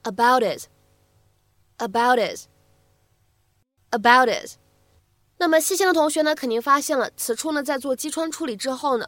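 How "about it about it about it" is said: "about his" said as "about it" with the h gone; "about it" with the h dropped; dropped h demo. Shown as a demonstration. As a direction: let "about his" slide into "about it". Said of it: "我们呢，可以读成".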